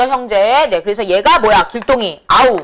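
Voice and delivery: usual speaking voice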